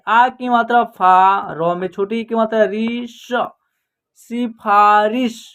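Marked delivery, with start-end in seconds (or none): 4.2-5.4 slow, syllable by syllable; slow clear word demo. Shown as a demonstration. Teacher reading a word to pupils, slowly and clearly. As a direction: sound it out slowly for the class. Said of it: "क्या उच्चारण होगा".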